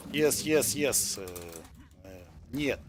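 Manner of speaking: halting put-on Russian, searching for words; pretend Russian accent. Shown as a demonstration. Streamer trying out Russian words for viewers, mock Russian accent. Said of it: "a pretend Russian accent".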